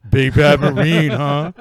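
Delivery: loud, sarcastic jeer on big bad Marine; wife taunting her fallen Marine husband, loud sarcastic jeer; mocking taunt. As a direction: sneer at him, loud and teasing. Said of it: "She's like".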